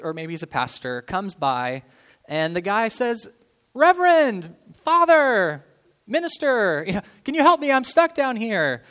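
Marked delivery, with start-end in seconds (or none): 3.7-8.8 high, loud pleading call upward. Retold or imitated. Man stuck in a hole begs a passing priest, loud and high. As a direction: shout up to the priest, begging for help.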